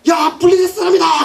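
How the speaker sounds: high acted alarm cry; calling out. Woman calling the Philistines on sleeping Samson, high acted shout. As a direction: call the men in with a high yell. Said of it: "남자들 불렀어요".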